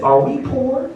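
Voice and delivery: put-on a high, small child's voice. Comedian voicing his daughter asking if they're poor. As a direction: pipe it up like a little girl.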